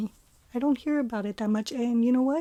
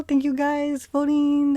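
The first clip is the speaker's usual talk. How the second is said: usual speaking voice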